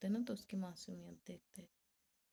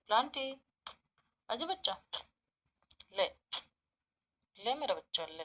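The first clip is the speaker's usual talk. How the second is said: high, louder call to a pet; calling the rabbit; 4 semitones higher, 5 dB louder than usual. Real, not acted. Owner calling her rabbit Planty to come, high coaxing call. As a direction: coax the bunny over with a high call.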